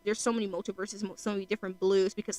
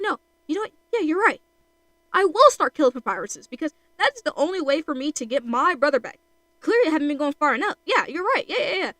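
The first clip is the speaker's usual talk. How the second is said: loud, high, hammed-up frenzy; unhinged resolve; 6 semitones higher, 6 dB louder than usual. Brother convincing himself to start killing for Papyrus, unhinged. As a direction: talk yourself into it, louder and higher. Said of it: "he, like, twigs out".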